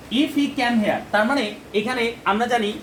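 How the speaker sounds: ordinary talk, nothing marked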